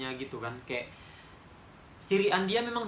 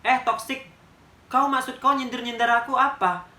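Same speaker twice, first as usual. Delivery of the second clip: much louder and higher, a raised furious voice; furious callout; 6 semitones higher, 7 dB louder than usual. Retold or imitated. To the friend who mocked her graduation, loudly furious. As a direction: let the hurt burst out as a loud attack. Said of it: "ku bilang gini aja".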